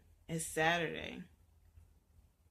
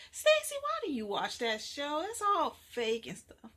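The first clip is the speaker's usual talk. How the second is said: louder, higher scolding mother; scolding mom; 6 semitones higher, 8 dB louder than usual. Acted. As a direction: tell her off loudly.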